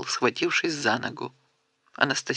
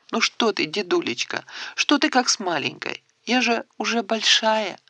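put-on higher, girlish, eager protest; a young girl flustered by the fuss; surprised and excited; 7 semitones higher than usual. A narrator's reading. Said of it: "удивлённо и взволнованно заговорила". (удивлённо и взволнованно)

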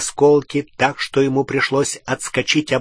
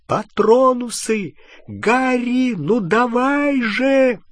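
urgent pleading; 11 semitones higher, slower than usual. A narrator's reading. Hermione urging Harry.

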